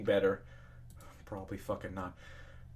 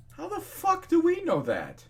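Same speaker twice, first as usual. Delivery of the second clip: exasperated rant. Real, not acted. Ranting at commenters.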